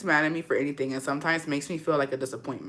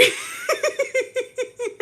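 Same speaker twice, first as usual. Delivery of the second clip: long, high helpless giggle; fit of giggles. Real, not acted. Host overcome mid-apology, long giggling fit. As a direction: giggle uncontrollably. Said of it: "I got the giggles".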